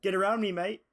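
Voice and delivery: loud, high caricature of an Aussie friend; hammed-up Australian matey call; mock Australian accent. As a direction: put on a big Aussie accent. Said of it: "And then Chris says".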